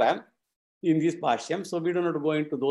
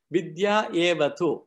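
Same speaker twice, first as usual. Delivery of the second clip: ordinary talk, nothing marked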